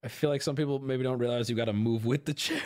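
ordinary talk

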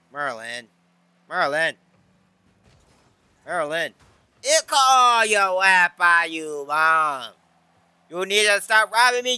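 ordinary talk

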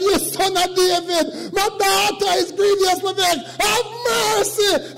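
high, loud desperate cry to the Lord; mother crying out to Jesus for her daughter; pleading shout. Acted. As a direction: beg for mercy at the top of your voice. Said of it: "She shouted".

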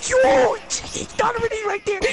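loud high excited call; excited callout. Real, not acted. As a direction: point him out, all excited.

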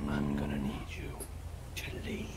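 hushed, voiceless repeat; whispering. Acted. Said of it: "same order then whispered".

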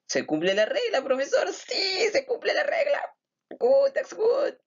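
very high, squeaky falsetto; excited pupil. Acted. Acted pupil telling the professor the rule applies, squeaky excitement. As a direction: squeal it like an eager little pupil.

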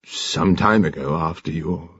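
low, subdued, halting; embarrassed, hesitant murmur; faltering commander. A narrator's reading. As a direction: stumble awkwardly and trail off. Commander falters over explanation.

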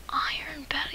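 usual speaking voice

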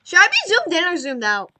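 shouting. Real, not acted. Yelling question during game.

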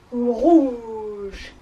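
big, drawn-out elephant sound. Shown as a demonstration. Teacher plays elephant for the class, big elephant voice. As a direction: stretch the word out like an elephant. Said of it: "like an elephant would".

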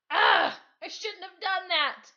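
sharp high yelp of pain, then rueful; high, loud pained father's admission; pained cry. Acted. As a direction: cry out from the sore fist. Dad hurts fist on wall.

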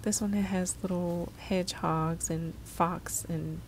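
usual speaking voice

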